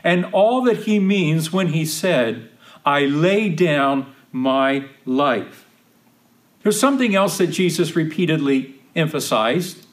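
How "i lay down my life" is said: slow deliberate emphasis. Shown as a demonstration. Key phrase slowed for emphasis.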